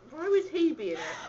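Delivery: high, words through laughter; giggling fit; laughing. Real, not acted. Friends crack up over a silly guess, laughing.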